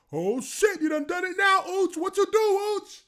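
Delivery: high, loud mocking tease; teasing teammates. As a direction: needle him, loud and high. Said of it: "these guys are all like".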